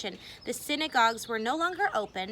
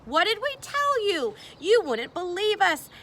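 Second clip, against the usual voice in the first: gloating, higher and louder, told-you-so triumph; jubilant; 5 semitones higher, 5 dB louder than usual. Acted. Optimists gloating to the worried townsfolk, jubilant and triumphant. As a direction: crow over the doubters like you've been proven right. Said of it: "The optimists were jubilant".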